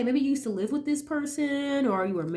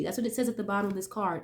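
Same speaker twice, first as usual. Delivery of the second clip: ordinary talk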